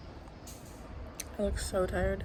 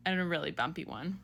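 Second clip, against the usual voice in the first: usual speaking voice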